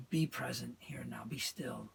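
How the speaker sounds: soft unvoiced whisper. Real, not acted. Teacher guiding listeners into stillness, whispered. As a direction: hush to a meditative whisper. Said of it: "guiding into stillness, whispered".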